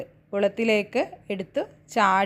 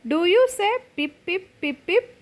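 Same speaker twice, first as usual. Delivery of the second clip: puppy's voice; 8 semitones higher than usual. Acted. Puppy asks honey bee.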